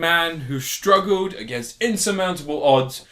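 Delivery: slow, theatrical storyteller delivery; mock-grand introduction. Host unveils the film reviewed this week, mock-grand introduction. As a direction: announce it with pomp, pausing for weight.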